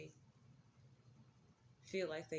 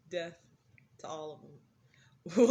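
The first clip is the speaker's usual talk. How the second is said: mock proclamation; 11 dB louder, slower than usual. Quoting grandma's outburst.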